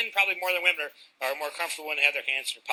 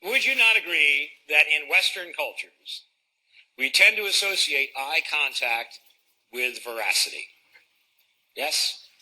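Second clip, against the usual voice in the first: usual speaking voice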